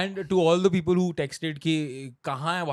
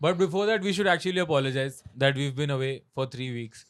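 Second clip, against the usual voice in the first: ordinary talk, nothing marked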